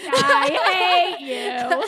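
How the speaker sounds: loud, slow, drawn-out mock hate. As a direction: belt it out like a mock curse. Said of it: "Do you hate me?".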